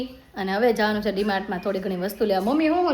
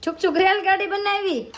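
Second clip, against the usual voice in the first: excited echo; 8 semitones higher than usual. Real, not acted. Mother echoing her toddler's train game with delight.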